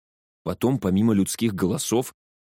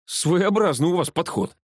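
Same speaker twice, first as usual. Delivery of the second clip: high, sardonic remark; wry, raised-pitch jab; smirking; 9 semitones higher than usual. A narrator's reading. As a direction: needle them with mock appraisal. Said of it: "ухмыльнулся я".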